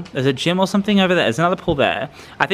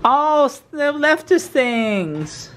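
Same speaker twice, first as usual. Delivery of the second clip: surprised delight; 12 semitones higher, much slower than usual. Real, not acted. Discovering gifts in room.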